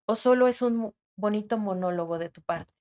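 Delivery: normal talking voice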